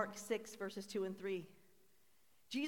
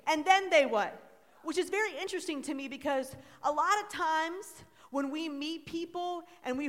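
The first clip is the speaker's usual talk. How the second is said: loud, rising call-and-response sermon; full-force preaching; 7 semitones higher, 11 dB louder than usual. Acted. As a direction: preach it out, asking the crowd to answer.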